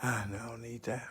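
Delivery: a private whisper while hunting for a file; whispered aside. Real, not acted. Host mutters to himself while searching his computer, whispered. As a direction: whisper it to yourself, barely audible.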